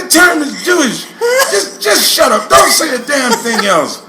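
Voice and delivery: high, loud imitated dad's telling-off; furious father. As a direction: lay down the law as an angry dad. Father reprimanding son, retold.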